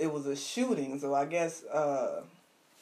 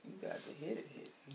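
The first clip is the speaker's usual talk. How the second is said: whispered; 2 semitones lower, 16 dB quieter, much faster than usual. Real, not acted. Co-host fading out quietly.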